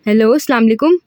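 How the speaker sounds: usual speaking voice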